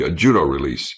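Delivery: usual speaking voice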